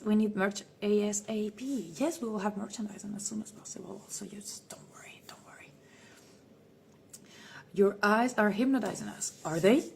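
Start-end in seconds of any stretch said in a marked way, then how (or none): 4.1-5.7 soothing whisper. Acted. Reassuring fans in whisper.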